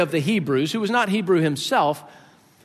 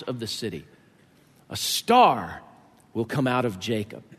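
solemn scripture reading; 4 semitones lower than usual. Acted.

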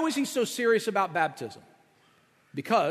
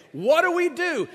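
desperate crowd cry; 10 semitones higher, 5 dB louder than usual. Retold or imitated. Crowd cut to the heart.

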